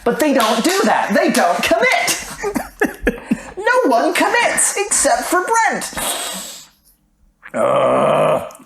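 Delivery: high-pitched, worked-up rant; exasperated outburst. Real, not acted. Co-host ranting that nobody commits, exasperated and high. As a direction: cry out in comic exasperation, voice shooting up.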